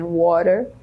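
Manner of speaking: "Water" with a tapped R in place of the T. Shown as a demonstration. Teacher demonstrating fast speech to learners, "Water" with an R-like T. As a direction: turn the T in "Water" into a light R tap. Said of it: "O T tem um som de R quando a gente fala bem rápido".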